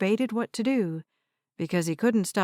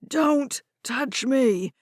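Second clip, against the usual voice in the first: put-on angry old man; 5 semitones higher, much slower than usual. A narrator's reading. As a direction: warn her off, furious. Old man slapping hands away.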